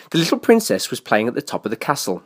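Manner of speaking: ordinary delivery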